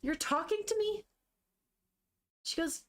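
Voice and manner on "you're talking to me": high, incredulous question, mildly indignant; acted disbelief, rising in pitch; angrily shocked. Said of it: "kind of angrily shocked".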